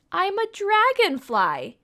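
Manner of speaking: high, bright-loud announcement of the answer; spring the answer like a surprise; excited reveal. Pretend. Teacher revealing the answer to children, excited and high.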